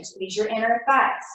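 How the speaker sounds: ordinary delivery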